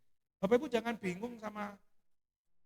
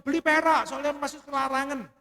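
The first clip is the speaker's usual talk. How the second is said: mock pastor; 6 semitones higher, 9 dB louder than usual. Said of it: "pendeta yang ngomong".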